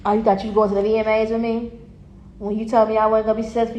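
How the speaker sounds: usual speaking voice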